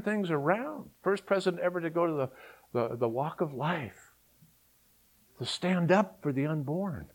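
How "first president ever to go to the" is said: ordinary delivery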